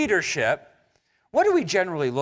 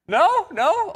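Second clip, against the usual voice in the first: teasing; 10 semitones higher, 4 dB louder than usual. Real, not acted. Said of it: "How many guys want this".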